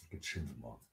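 ordinary delivery

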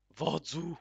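loud, high call with a rising end. Real, not acted. Gamer calling out loudly to the chief character. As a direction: hail the chief from afar.

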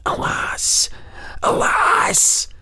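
put-on breathy whispered cry of grief from an old goblin; a wrinkled little goblin sighing out his sorrow; sorrowful goblin whisper. Goblin mourning to the Earl, whispered lament. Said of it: "with a look of sorrow".

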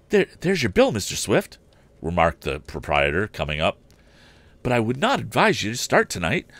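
lightly voiced fussy hotel keeper; flustered proprietor. Hotel proprietor apologizes to the departing guest, hurried.

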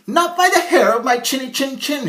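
high, loud piglet refusal; defiant little pig. Acted. Harold refuses the wolf from inside, high and loud. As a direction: squeal it back defiantly. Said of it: "Harold replied".

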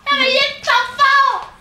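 high furious outcry; furious cry. Acted. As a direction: rail at being cheated. Furious landlady.

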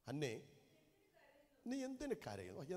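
soft, gentle husband asking why she weeps; a husband's quiet loving concern; tender loving question. Retold or imitated. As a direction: ask it softly, full of love. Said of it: "എന്തൊരു നല്ല സ്നേഹത്തിൽ ആ വാക്ക്".

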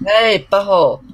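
loud, high indignant yell; angry shout. Real, not acted. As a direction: yell it at the zombie. Attacked by baby zombie.

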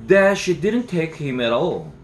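ordinary delivery, nothing marked

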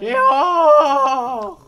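high, loud urgent shout; desperate yell. Real, not acted. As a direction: yell it at your teammate. Urging teammate, repeated louder.

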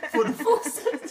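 laughing, high and loud. Real, not acted. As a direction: crack up while asking. Laughing at food stamps.

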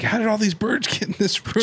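high-pitched mock bafflement; imitated baffled onlookers. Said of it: "the only thing anybody can talk about".

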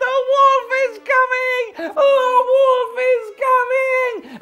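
very high, drawn-out yell; shouting as loud as possible. Acted. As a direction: raise a false alarm at full voice. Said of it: "as loud as he could, he shouted".

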